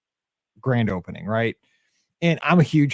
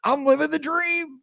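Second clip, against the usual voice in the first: high, slow, giddy boast; high drawn-out delight; gleeful; 14 semitones higher than usual. Retold or imitated. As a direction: savour it like a kid. Exultant retold thought.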